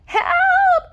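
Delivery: very high, loud cry for help; yelled help. Acted. Cats crying out in the moonlight, panicked yell. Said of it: "cried the cats".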